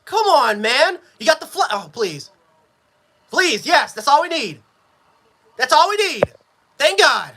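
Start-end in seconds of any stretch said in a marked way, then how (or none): none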